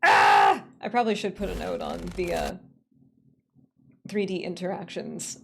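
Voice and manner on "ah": short, very loud yell; frustrated shout. Real, not acted. Streamer fed up with broken alerts, frustrated shout. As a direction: let out one wordless frustrated shout.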